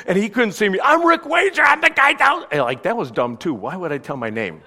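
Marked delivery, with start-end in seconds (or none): 0.8-2.5 high, loud hurried self-introduction; urgent shout. Retold or imitated. Boy shouting his name up to the neighbor, loud. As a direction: call out your name in panic. Kid identifying himself, shouting.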